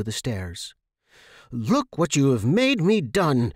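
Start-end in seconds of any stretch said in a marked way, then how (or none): 1.5-3.5 high, loud screech. Acted. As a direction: screech it in anger. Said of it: "she screeched".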